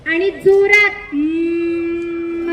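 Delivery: long, slow, loud humming; loud hum. Shown as a demonstration. Teacher showing children the bee breath, long hum. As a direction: hum hard so it rings in the ears. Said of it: "असं म्हणायचंय".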